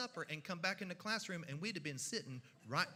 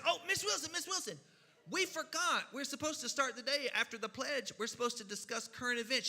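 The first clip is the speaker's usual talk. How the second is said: put-on higher pleading child voice; sweet kid voice; 5 semitones higher than usual. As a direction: play the eager pupil stalling the teacher. Kids sweetly distracting the teacher.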